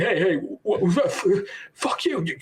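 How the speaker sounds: stammering, high-pitched mock outrage; flustered comeback. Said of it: "you don't come out, like, as nearly as eloquent".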